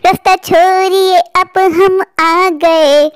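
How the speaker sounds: slow chanted witch threat. Acted. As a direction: sing out the revenge. Witches return for revenge.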